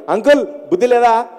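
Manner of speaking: daughter's friends tease the uncle; kids teasing. Retold or imitated. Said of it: "అంటున్నారు".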